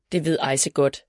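ordinary delivery, nothing marked

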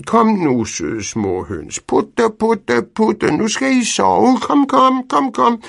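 high, loud coaxing call; calling hens. A narrator's reading. Pedersen calling the hens into the henhouse, high and coaxing. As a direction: lure the hens home with a sweet call.

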